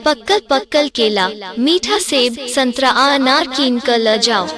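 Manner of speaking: ordinary delivery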